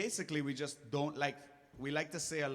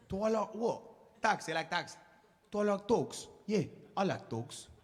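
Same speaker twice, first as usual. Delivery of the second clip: quick mock Pikey accent, a little higher; Snatch-style mock accent; 5 semitones higher than usual. Parodying Snatch as Caribbean, mock Pikey accent. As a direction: fire it out in a garbled Pikey accent. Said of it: "Caribbean snatch".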